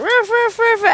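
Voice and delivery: high, quick mock dog barks; imitated barking. Dogs all start barking as she returns, imitated yaps. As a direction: bark like several little dogs going off. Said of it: "They all start barking".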